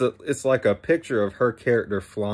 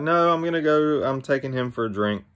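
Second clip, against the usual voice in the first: a mildly insistent, higher and louder retort; higher-pitched retold objection, easing down as it goes; insistent refusal; 6 semitones higher, 4 dB louder than usual. She turns down his table invitation, mildly insistent.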